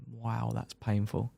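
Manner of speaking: quiet, slow, deadpan; understated pain. Retold or imitated. Rider recalling his crash, quietly understated. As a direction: say it softly as if it barely hurts.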